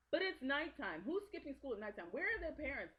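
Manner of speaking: high, loud, incredulous questioning; exasperated disbelief. Real, not acted. Mock outrage at the film's plot.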